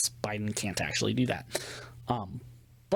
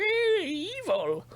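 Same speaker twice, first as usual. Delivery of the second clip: high squeaky mock gasp of horror; mock outrage; slower than usual. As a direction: mimic the shocked coworkers, pitched way up. Mocking liberal coworkers' outrage.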